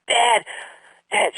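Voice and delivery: high, eager calls of Dad; excited daughter. Retold or imitated. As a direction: burst in with the good news. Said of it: "she was so excited".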